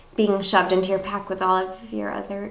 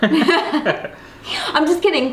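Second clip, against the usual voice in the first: laughing it off, drawn out; 6 semitones higher, 4 dB louder, much slower than usual. Real, not acted. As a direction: giggle through the words. Laughing retraction.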